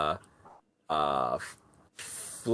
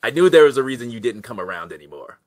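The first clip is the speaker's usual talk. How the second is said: bitter rush; 8 dB louder, much faster than usual. Acted. Goblin pulling loose, bitter.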